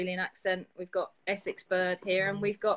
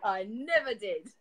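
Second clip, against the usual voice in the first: mock outrage; 4 dB louder, slower than usual. Co-host mock-accuses her of lying.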